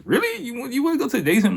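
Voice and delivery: very high, fast disbelieving driver; incredulous taxi driver. Retold or imitated. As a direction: sound amazed at the odd request. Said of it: "he's like".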